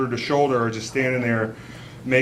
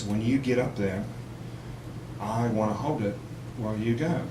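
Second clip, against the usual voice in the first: soft, low murmur; shy confession; 4 semitones lower, 6 dB quieter than usual. Retold or imitated. Comic voicing his girlfriend confessing a secret wish, soft and hesitant. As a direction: confide it quietly, understated.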